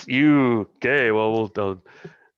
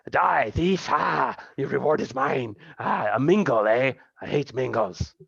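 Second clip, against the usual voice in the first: hostile bandit. Acted. GM voices enemy attacking.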